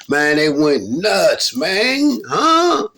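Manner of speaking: loud, very high excited yell; hyped shout. Real, not acted. Storyteller reliving the crowd going wild, loud and high.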